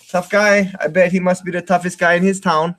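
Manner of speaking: normal talking voice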